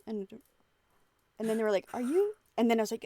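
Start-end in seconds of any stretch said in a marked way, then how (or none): 1.9-2.3 high, loud eager question; a fan catching on; excited recognition. Retold or imitated. Clerk recognizing streamer.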